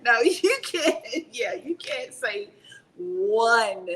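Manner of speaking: laughing as she protests; real laugh through the words. Real, not acted. Laughing at the interviewer's impossible question.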